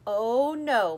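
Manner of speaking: loud, dismayed Oh no; alarmed cry. A narrator's reading. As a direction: she just realized the danger. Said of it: "my mother cried".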